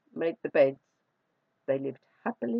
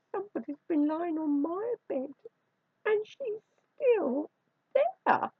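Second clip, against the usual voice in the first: tiny high voice rising to a tearful wail; squeaky, wailing Baby Bear; 11 semitones higher than usual. A narrator's reading. Baby Bear finding someone in his bed, squeaky wail. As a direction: wail it like a little squeaky cub. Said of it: "wailed Baby Bear in a squeaky little voice".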